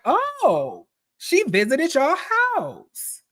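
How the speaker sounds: high, drawn-out "oh" of retold surprise; mock surprise. Retelling a cast member's reaction to the house visit, mock surprise. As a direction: act out a slow, high-pitched astonished reaction.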